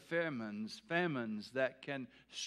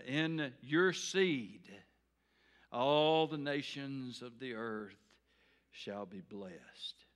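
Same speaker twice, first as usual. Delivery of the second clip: stately pace with long pauses, hushed close; slow emphatic quotation; slower than usual. Shown as a demonstration. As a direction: spell out the promise phrase by phrase. Verse repeated slowly for emphasis.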